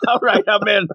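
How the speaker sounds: amused eagerness. Retold or imitated. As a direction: sign up with a grin. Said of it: "but I'm like".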